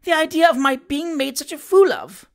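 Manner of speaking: a forced, hammed-up wail; mock tearful outrage. A narrator's reading. Daughter complaining to her parents, hammed-up tearful outrage. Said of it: "forcing a tear".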